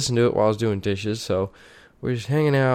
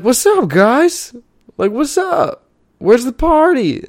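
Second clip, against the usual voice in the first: much higher, slow mock greeting, louder; high mock party dude; 13 semitones higher, 5 dB louder, slower than usual. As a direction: play the clueless guy barging in, high and loud. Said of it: "is like".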